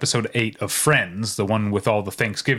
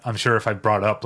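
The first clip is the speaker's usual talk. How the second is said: normal talking voice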